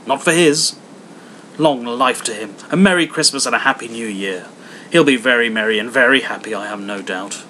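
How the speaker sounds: loud, mocking good wishes; wife toasting Scrooge grudgingly, loud and sarcastic. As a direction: toast him with biting sarcasm. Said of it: "wife's sarcastic toast".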